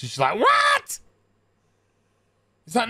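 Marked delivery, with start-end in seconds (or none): 0.4-1.0 very high, incredulous squawk; mock outraged shriek. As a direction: shriek it in hammed-up disbelief. Acting wife's outraged reaction.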